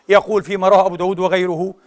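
ordinary talk, nothing marked

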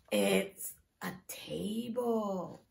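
ordinary delivery

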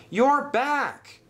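high-pitched happy cry; excited shout. Acted. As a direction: call out in delighted surprise. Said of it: "shouted Oliver".

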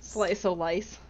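"lice" ending in an s sound, no f; echoed mispronunciation. Retold or imitated. Player echoing a friend's slip, "lice" said for life.

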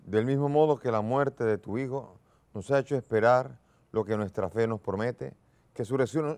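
solemn intoned collect at the altar; liturgical prayer. Acted. Priest leads the collect prayer.

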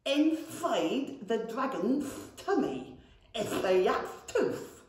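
slow, proud lisping goblin. A narrator's reading. Proud goblin shows brother his tooth, lisping. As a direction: lisp it proudly round the tooth. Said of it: "said Seepage proudly".

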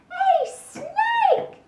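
very high squeal of alarm; alarmed cry. Acted. Gecko waking up beside a snake, crying out in alarm. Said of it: "he shouted".

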